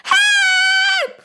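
high piercing scream of a woman; a woman's distant shrill scream for help; shrill cry for help. A narrator's reading. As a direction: shriek it, far off and desperate. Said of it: "the shrilly sound came".

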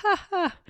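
high, short witch's laugh; a bright little cackle. A narrator's reading. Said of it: "The witch started to laugh".

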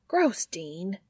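a breathy whispered "gross" of disgust; whispered protest. A narrator's reading. Younger brother whispers his disgust at Dean's joke. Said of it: "Sam protests".

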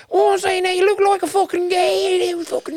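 put-on very high, shrill old Nan scolding; hammed-up granny nagging her grandson; old Nan teasing his tight pants; grandmother voice. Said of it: "And you're like".

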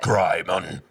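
loud zombie groan of the word. Acted. Joking couple name as if we were a zombie, zombie voice. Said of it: "That was if we were a zombie".

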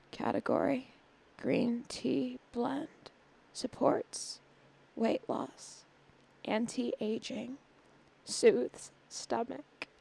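soft, slow sensual read with lingering pauses; mock sensual voice. Host reading tea label as running gag, mock sensual voice. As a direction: purr the tea label very softly and slowly. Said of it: "I tend to read it in, like, a sensual voice".

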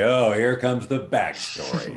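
slow, sing-song groan; mock bored sigh. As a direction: tease it like a bored listener. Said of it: "mock voice of listeners".

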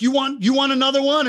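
imitating an angry principal; mock angry principal. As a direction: bark it like a fed-up principal. Principal shouting in retold scene.

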